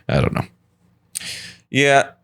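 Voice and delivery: ordinary talk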